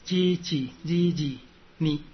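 叽叽叽叽 slow and evenly spaced, then 你; consonant drill. Shown as a demonstration. Teacher demonstrating the next consonant group, slow syllable drill. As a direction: draw out each syllable of 叽叽叽叽 你. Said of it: "第二个主音".

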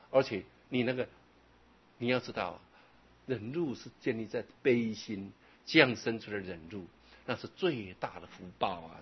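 usual speaking voice

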